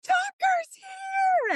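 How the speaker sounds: very high, drawn-out yell; imitated yelling. Daughter yelling from the backyard that the dog is found, high drawn-out yell. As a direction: mimic a child yelling the news from afar. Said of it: "yelling from the backyard".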